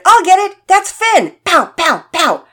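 put-on very high, loud excited boy yell; shouting. A narrator's reading. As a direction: holler it playing war games. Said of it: "Pearson shouted".

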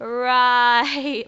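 loud, sarcastic "Right"; a loud, disbelieving "Right". Retold or imitated. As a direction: scoff at his excuse out loud. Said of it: "And I'm like".

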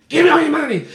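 high, loud stick-up holler; mock robber yell. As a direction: bark it like a fake mugger. Prank robbery shout retold.

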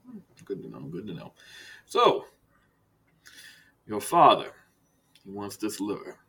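low, soft, measured director voice with long pauses; Director Silas, understated menace. Acted. Director turns to business with Archibald, quiet and measured. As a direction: speak quietly and let each phrase hang.

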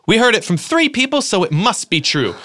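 high, eager insisting Daisy voice. Retold or imitated. Reading Daisy's lines to a friend, high and insistent. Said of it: "insisted Daisy".

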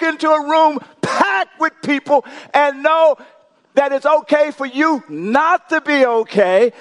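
high, rousing preacher's delivery; rally the room, stretching the big statements; full-force preaching. Real, not acted. Preacher urges congregation to admit they're not okay, full-force preaching.

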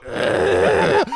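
low, breathless mock groan of a dead deer. Kid telling brother about the deer's stare, mock groan. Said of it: "there's a deer looking at me going".

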